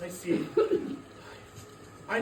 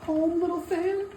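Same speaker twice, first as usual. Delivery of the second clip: surprised; 8 semitones higher, 4 dB louder than usual. Acted. Scrooge surprised by sister.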